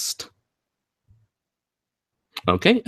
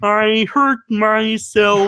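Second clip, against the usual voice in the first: mock Kermit whimper; 6 semitones higher, much slower than usual. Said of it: "Kermit the Frog".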